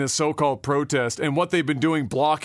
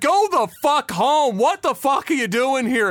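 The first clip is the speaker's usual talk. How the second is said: high, emphatic mock tirade; acted-out chewing-out, words stretched; retold angry yelling; 7 semitones higher, 3 dB louder than usual. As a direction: imitate a man laying into people below. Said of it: "he just fucking lays into him".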